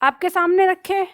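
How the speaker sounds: ordinary talk, nothing marked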